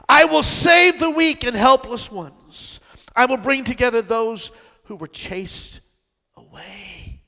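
loud, slow, swelling proclamation; preaching at full force. Acted. Preacher proclaiming God's promise to the church, loud and slow. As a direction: proclaim it slowly to the back row.

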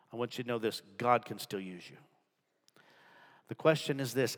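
usual speaking voice

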